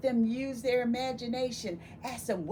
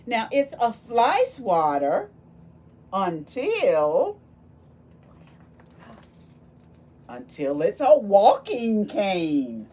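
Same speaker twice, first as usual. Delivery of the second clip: dramatic refrain; 9 dB louder than usual. Acted. Story refrain read dramatically.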